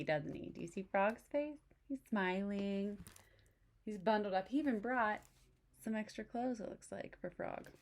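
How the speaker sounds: ordinary delivery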